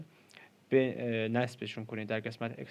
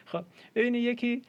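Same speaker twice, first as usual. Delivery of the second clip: laughing; 11 semitones higher, 6 dB louder than usual. Real, not acted. Laughs while speaking.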